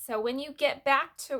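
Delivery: ordinary delivery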